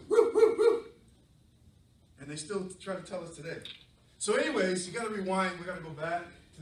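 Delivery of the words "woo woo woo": high, loud howling woo; dog howls. Acted. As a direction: howl like a dog. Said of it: "all we heard was".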